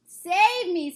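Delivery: high, loud scream; terrified giant screaming. Acted. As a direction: scream for rescue. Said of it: "screamed the flesh lump eater".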